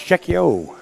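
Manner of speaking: "Czechio" ending in a long oh, loud and high; correct pronunciation. Shown as a demonstration. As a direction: say "Czechio" as CHECK-ee-oh, announced firmly. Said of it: "official word on the correct pronunciation".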